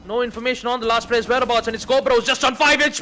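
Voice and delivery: loud, high play-by-play building to shout; esports caster. Real, not acted. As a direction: call the clutch, rising to a shout. Live play-by-play, clutch round.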